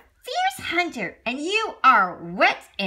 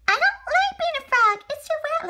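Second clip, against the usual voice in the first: put-on high, whiny kid frog; little frog child; 14 semitones higher, 4 dB louder than usual. Son frog whines to Daddy in a high child voice. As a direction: pipe up like a small frog child.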